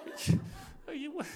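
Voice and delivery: high, startled laughing disbelief; a stunned, laughing what-the; incredulous disbelief. Retold or imitated. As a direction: tour guide who can't believe the plan. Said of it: "the guy's like".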